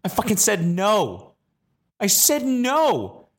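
high-pitched disbelief at himself; incredulous confession. Real, not acted. Storyteller appalled he refused a scared kid, high incredulous confession. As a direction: squeal it out, drawing out each "No".

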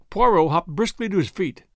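ordinary delivery, nothing marked

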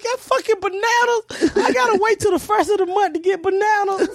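put-on high, excited kid's disbelief; amazed child. Child yelling at the ape about bananas, high excitement. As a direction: cry it out like a kid who can't believe it.

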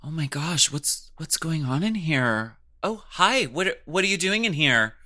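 high, fake-surprised gasps; mock surprised producer. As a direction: play up staged shock. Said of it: "it's like".

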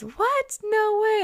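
high-pitched party-guest disbelief, acted out; mock astonishment. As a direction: squeak it like an amazed listener. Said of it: "Everyone goes".

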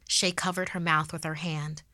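plain reading voice

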